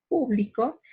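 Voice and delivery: público with the stress on the first syllable, pú-. Shown as a demonstration. Teacher moving the accent to the first syllable, pú-. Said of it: "si el acento se ubicase en pu".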